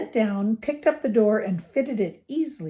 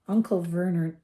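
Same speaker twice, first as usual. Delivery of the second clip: plain reading voice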